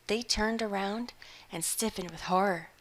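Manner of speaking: plain reading voice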